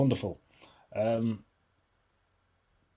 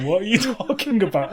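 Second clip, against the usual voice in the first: put-on girlfriend's puzzled question; 11 semitones higher, 5 dB louder than usual. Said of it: "She was like".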